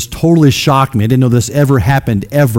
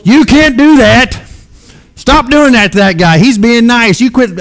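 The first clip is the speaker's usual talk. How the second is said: high, loud, hammed-up old lady yelling; grandma yelling at TV; 11 semitones higher, 4 dB louder than usual. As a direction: yell at the wrestlers on TV like an outraged grandma. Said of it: "she would yell at the T V".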